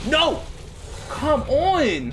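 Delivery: real gamer frustration; frustrated outburst. Real, not acted. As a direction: exclaim in real exasperation at the game. Game going against the player.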